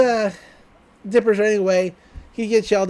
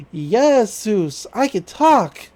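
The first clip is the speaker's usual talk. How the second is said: slow, drawn-out pretend toy voice; talking duck voice; 4 dB louder, much slower than usual. Inflatable duck revealing itself, slow put-on voice. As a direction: stretch it out like a mysterious talking toy.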